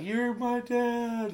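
high, slow, drawn-out plea; mock high wail of a betrayed son; high pleading. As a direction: beg him with a high stretched voice. Son pleading as he's shut out.

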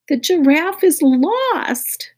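high, slow teary wail; lightly voiced sobbing girl. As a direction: wail it through tears. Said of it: "sobbed Dana".

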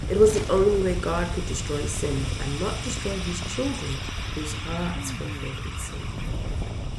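ordinary delivery, nothing marked

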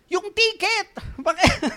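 sudden shout across the bus, high and loud. Retold or imitated. Passenger on a bus yelling at a distracted seatmate, loud shout. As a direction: yell for the ticket to be passed. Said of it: "ang lakas na ng boses ko".

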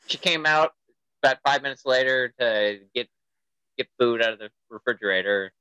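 ordinary talk

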